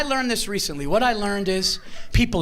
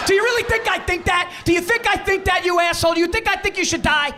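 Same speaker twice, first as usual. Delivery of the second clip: exasperated shouting at a heckler; exasperated outburst; 10 semitones higher than usual. Acted. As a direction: fire it back at her, indignant. Arguing back at the offended woman.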